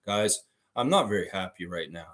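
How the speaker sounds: a hint of stoic OG, no feeling shown; emotionless OG. Streamer mocks OG saying he's unhappy, flat deadpan. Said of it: "He's like".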